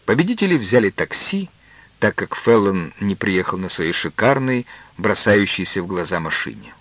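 plain reading voice

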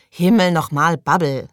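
loud, impatient outburst; exasperated exclamation. A narrator's reading. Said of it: "rief Susie". (genervt und laut gerufen)

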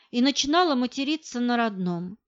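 ordinary delivery

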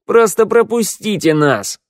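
high, loud begging; pleading cry. A narrator's reading. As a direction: plead urgently to pass. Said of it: "взмолилась Шныряла". (отчаянная мольба)

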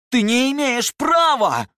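slow, very high screaming shout; furious shout. A narrator's reading. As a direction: scream it in rage. Officer furious at Mark.

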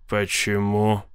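low, restrained, cool question; cold inquiry. A narrator's reading. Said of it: "холодно осведомился Гилмер". (холодный вопрос низким голосом)

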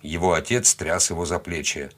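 usual speaking voice

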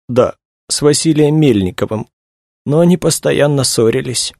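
high-pitched female character voice; distressed young woman. A narrator's reading. Young woman tells investigators about the artist, upset.

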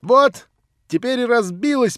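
high, loud, slow, challenging; defiant triumph. Acted. Nelly after smashing the cup, defiant triumph. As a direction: gloat defiantly. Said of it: "с каким-то вызывающим торжеством".

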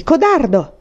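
high, howling taunt; howled insult. A narrator's reading. Enraged boy calls rival a coward, howling. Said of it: "ululò quindi".

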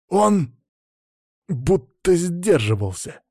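high, slow, through gritted teeth; angry, forced out. A narrator's reading. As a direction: squeeze the words out with resentment. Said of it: "со злостью процедил Антон". (со злостью, сквозь зубы)

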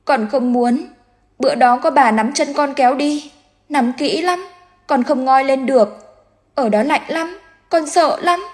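put-on high, thin child's voice, scared and pleading; a drowned boy recalling the cold water; frightened ghost child. A narrator's reading. Dead son telling mother how he drowned, high and frightened.

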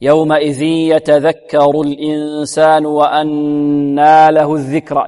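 slow recitation, long nasal ن in الإنسان and وأنى; fuller ghunnah. Shown as a demonstration. Teacher reciting for students, slow with full ghunnah on وأنى. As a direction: stretch both nasal noons in الإنسان وأنى. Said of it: "تكون بغنة أكمل".

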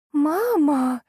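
high-pitched cry of alarm; frightened squeal. A narrator's reading. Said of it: "взвизгнула девушка". (испуганный девичий визг)

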